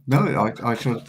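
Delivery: usual speaking voice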